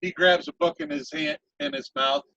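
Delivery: usual speaking voice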